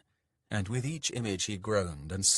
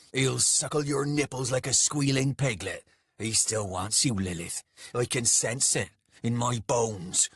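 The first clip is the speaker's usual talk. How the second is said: rough-spoken man's bitter rant, pushed louder in bursts; jealous, angry brute; 3 semitones higher, 4 dB louder than usual. Acted. Soldier rants at Lilith about his rival, jealous and angry.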